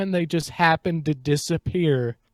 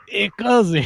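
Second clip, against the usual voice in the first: mock cousin voice; 4 semitones higher, 5 dB louder than usual.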